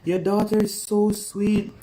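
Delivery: high, gushing imitation of admiring strangers; gushing Canadians. Mother mimicking Canadians praising her daughter, high and gushing. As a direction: coo over the pretty child. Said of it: "they look at her and I'm like".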